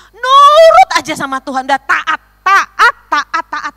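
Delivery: loud, high exhortation; emphatic preaching. Real, not acted. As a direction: drive each repeated word at the congregation. Preaching at full force.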